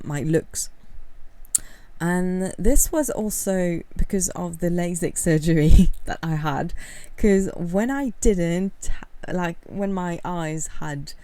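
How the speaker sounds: ordinary delivery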